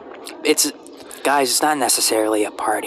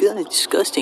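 mock disgust; 7 semitones higher than usual. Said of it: "people are like".

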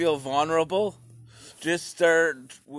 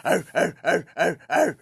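louder primal grunts; caveman grunts; 4 semitones higher, 5 dB louder than usual. Retold or imitated. Peeing outside and feeling primal, caveman grunts.

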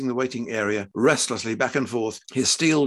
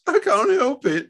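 high-pitched tearful wail; sobbing; 14 semitones higher, 5 dB louder than usual. A narrator's reading. Wife defending herself to her husband, sobbing. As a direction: protest through acted tears. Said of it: "she sobbed".

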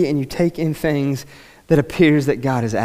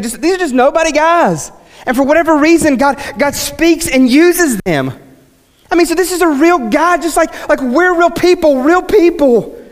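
high-pitched, forceful preacher voice; impassioned preaching; 11 semitones higher, 5 dB louder than usual. Acted. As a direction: preach at full fervour, pitched way up.

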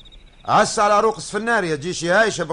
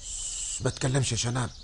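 hushed whisper; 7 semitones lower, 10 dB quieter than usual. Acted. Hushing companion in hiding.

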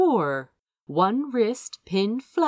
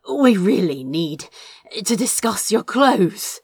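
voiced a bit louder, panting between words; breathless panting; 2 semitones higher, 6 dB louder than usual. A narrator's reading. Crow to Azra while undressing, breathless and panting. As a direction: push it out short of breath, mid-kiss. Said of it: "Crow panted".

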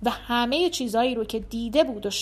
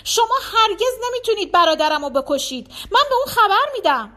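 high, loud defiant cry; outraged cry; 8 semitones higher, 6 dB louder than usual. A narrator's reading. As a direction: cry out in protest, rising higher into a threat. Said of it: "فریاد کشید".

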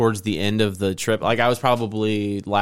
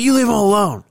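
put-on high, louder kid voice defending a friend; mock shouting kid; 12 semitones higher, 5 dB louder than usual. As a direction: play the brave little friend yelling at bullies. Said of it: "He's like".

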